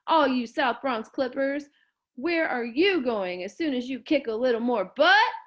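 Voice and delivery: announcer voice; announcer hype. Acted. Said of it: "made his voice even deeper, TV announcer".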